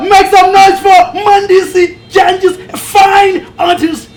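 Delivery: loud announcer hype; hype shout. Real, not acted. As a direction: shout it out to the crowd. Hypes up the guest artist.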